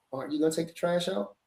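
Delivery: gentle ask. Shown as a demonstration. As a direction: ask kindly, softly. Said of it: "Or I could say".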